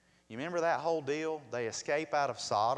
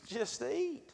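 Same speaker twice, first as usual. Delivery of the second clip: emphatic repeat; 12 semitones higher than usual. Acted. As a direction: push the second "eat" up high. Preacher repeating punchline louder.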